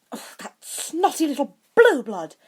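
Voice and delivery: high, loud, snarling contempt. A narrator's reading. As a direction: spit it out like an insult. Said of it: "she was known to snarl".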